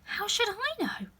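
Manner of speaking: bold retort. Acted. Alice answers the Queen back, high. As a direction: surprise yourself answering back. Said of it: "surprised at her own courage".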